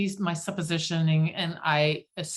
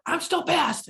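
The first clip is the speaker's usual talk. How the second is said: pouty high insistence, still mad; mock indignant; 10 semitones higher than usual. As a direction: snap it back, still fuming. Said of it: "and I'm like".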